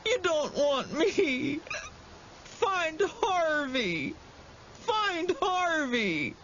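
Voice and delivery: high, slow tearful whine; whining. A narrator's reading. Boy whining through tears to the monster. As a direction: whine it, all snot and tears. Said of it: "still Wendell whined".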